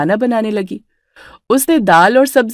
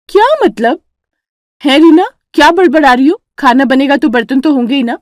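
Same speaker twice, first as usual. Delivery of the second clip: higher, louder indignant sister-in-law; sharp raised quarrel voice; 4 semitones higher, 3 dB louder than usual. Acted. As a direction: take offence and snap back. Vimla quarrels with Reena.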